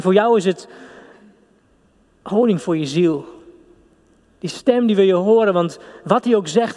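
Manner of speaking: normal talking voice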